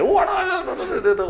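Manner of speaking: imitating a father scolding, high and loud; hammed-up grumbling waduh, waduh; nagging rant. Father nagging his son about a loan, in a high, loud mock grumble. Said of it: "papa saya langsung ngomel gitu".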